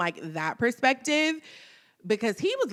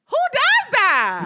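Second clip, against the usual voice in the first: indignant; 12 semitones higher, 7 dB louder, slower than usual. Real, not acted. Indignant outburst.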